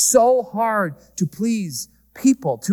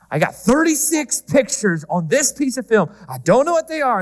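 mock exasperation; 6 semitones higher than usual. Acts out old-days film customer.

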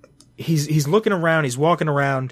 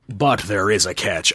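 Prickly Pete; 6 semitones lower than usual. Acted. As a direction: take your time, low piratical voice. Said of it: "he's a pirate".

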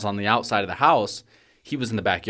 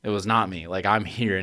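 usual speaking voice

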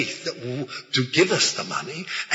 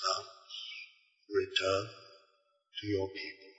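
hushed otherworldly voice; 8 semitones lower, 14 dB quieter than usual. Retold or imitated. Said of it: "this voice said".

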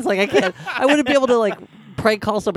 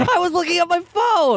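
shouting. Real, not acted. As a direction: yell it in defense. Shouted excuse.

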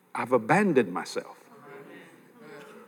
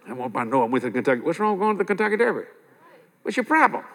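normal talking voice

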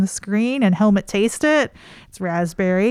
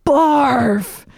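barks his own name, higher; gruff announcement; 5 semitones higher, 4 dB louder than usual. Retold or imitated. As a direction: blurt the name out loud. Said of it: "he's gotta deliver it like that".

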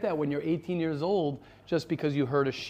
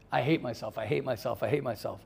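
softer, lower "I hate myself", weighed down on hate; a quieter, dejected admission; glum self-loathing; 4 semitones lower, 6 dB quieter than usual. Retold or imitated. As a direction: mutter it like the truth slipping out. The real inner voice answers.

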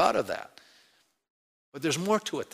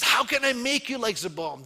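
higher, louder anguished plea; aching divine lament; God pleading; 7 semitones higher, 4 dB louder than usual. Acted. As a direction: voice it as a heartbroken God. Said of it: "He says".

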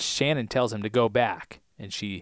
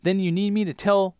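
higher-pitched indignant demand; Michael; 8 semitones higher than usual. Acted. Michael challenging Ana Lucia to explain, high-pitched indignation. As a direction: put on an exasperated, worked-up man. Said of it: "You're doing a great Michael right now".

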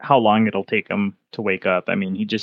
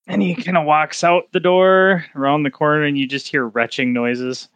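ordinary talk, nothing marked

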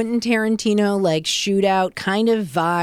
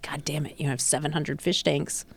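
muttered curse; 5 semitones lower, 9 dB quieter than usual. Retold or imitated. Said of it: "I'm like".

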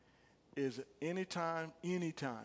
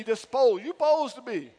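supposed said in full, S U P sounded out, loud and high; stress on supposed; 8 semitones higher, 15 dB louder than usual. Shown as a demonstration. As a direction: punch every syllable of supposed, keep the su. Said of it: "you don't leave out the S U P".

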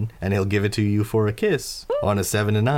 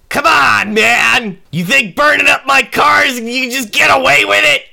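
high, loud shouted accusation; angry yelling; 11 dB louder than usual. Acted. Car owner outside accuses the man inside, angry shout. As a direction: holler the accusation from out in the street.

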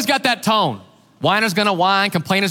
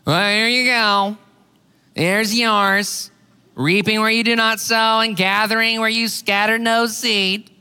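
blaming servant; 3 semitones higher than usual. Retold or imitated. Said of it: "Here's this guy blaming Jesus".

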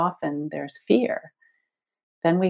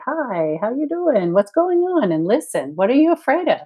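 tender coaxing; 8 semitones higher, 3 dB louder than usual. Retold or imitated. Greeting one's scared inner child.

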